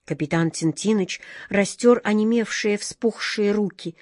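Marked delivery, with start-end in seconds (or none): none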